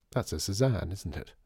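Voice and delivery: usual speaking voice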